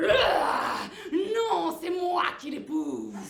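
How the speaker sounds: loud wolf claim. Acted. Wolf shouting from the dark forest, slow booming claim. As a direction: bellow it like a jealous wolf.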